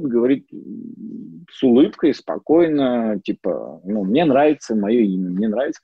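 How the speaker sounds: ordinary talk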